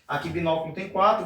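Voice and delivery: ordinary talk, nothing marked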